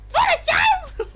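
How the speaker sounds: very high, loud haughty princess shout; Peach voice. Acted. Streamer auditioning Peach voice for the princess, loud high shout. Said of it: "the Peach voice".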